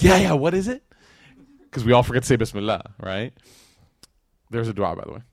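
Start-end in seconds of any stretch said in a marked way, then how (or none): 0.0-0.8 put-on high, loud eager children; a crowd of eager kids asking. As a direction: chime in like excited kids.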